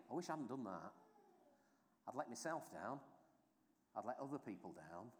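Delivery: low, softened voice of self-reproach; quiet regret. Acted. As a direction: think it aloud regretfully, soft and low. Inner thoughts of regret.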